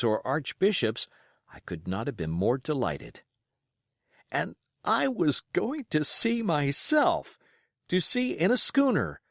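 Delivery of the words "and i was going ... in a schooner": thrilled. A narrator's reading. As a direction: let the boy's delight lift the voice. Boy thrilled to be going to sea.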